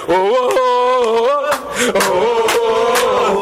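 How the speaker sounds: long drawn-out chorus of oh; passengers wailing. Retold or imitated. Passengers answer the pilot, drawn-out wailing oh. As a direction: stretch every oh slowly, like a frightened crowd. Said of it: "tout passager répond".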